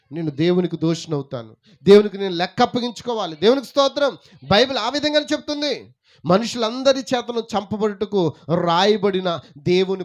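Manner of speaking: loud, high-pitched sermon delivery; full-force preaching. Acted. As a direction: proclaim it to the whole congregation. (గొంతెత్తి ఉద్వేగంగా ప్రసంగిస్తూ)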